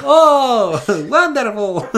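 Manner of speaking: very high, loud hammed-up rapture; mock delight. Friend reacting to the Mother Russia bit, hammed-up delight. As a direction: gush with exaggerated wonder.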